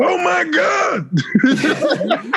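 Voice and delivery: high drawn-out shriek of surprise; shocked. Real, not acted. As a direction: squeal it out in disbelief. Discovers the tiles move.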